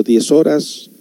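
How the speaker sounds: ordinary talk, nothing marked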